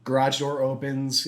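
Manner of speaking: ordinary talk, nothing marked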